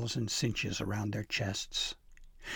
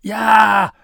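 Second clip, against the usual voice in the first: high, very loud yell; startled yell; 10 semitones higher, 16 dB louder than usual. A narrator's reading. Elf tumbling out of the sleigh, yelling. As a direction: yelp in sudden alarm. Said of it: "Pym yelled".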